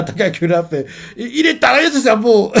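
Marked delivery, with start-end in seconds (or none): 1.2-2.5 stupéfaction. Retold or imitated. Takakura ébahi.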